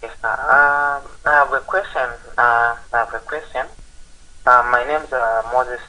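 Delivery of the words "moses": usual speaking voice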